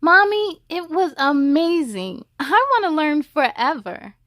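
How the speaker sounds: put-on excited child. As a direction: gush to mommy.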